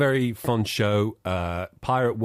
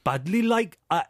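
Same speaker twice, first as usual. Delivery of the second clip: high, singsong, effortful Geordie; bad Geordie accent; 9 semitones higher than usual. Shown as a demonstration. Host says it takes brain processing power, bad Geordie accent. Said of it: "if I try and do a Geordie accent".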